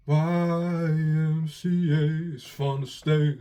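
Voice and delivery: slow, loud drawn-out Trump singing; Donald Trump impression. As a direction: stretch each letter like Trump. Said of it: "like Donald Trump".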